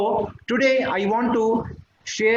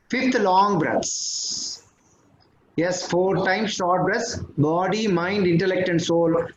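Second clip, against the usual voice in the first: guided meditation. Acted. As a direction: guide the group gently through breaths. Leads a breathing meditation.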